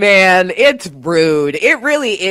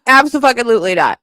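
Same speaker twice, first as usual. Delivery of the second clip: rapid repeat; 5 semitones higher, much faster than usual. Real, not acted. Emphatic refusal repeated.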